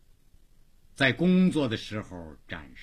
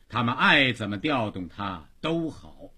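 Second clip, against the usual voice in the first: usual speaking voice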